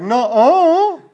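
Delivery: loud, high drawn-out protest; big hammed-up wail of dismay; acted dismayed cry. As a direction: cry out as if being exposed. Imagines own sins exposed at trial.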